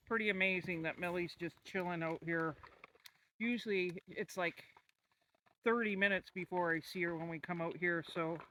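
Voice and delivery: ordinary talk, nothing marked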